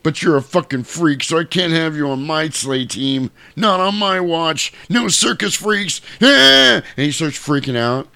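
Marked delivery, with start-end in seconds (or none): 0.0-6.0 mock-stern dismissal, rising in pitch; scornful Santa. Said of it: "he's an asshole again". 6.2-6.8 short, high, loud yell; freaked-out cry. Retold or imitated. Santa starts freaking out.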